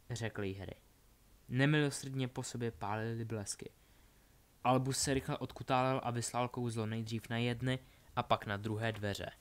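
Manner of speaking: usual speaking voice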